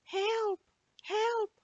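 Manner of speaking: tiny, very high cry for help from far off; a small voice calling weakly from outside; faint cry. Acted. Melting biscuit calling up from below the window in a tiny, very high voice. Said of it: "the voice said again".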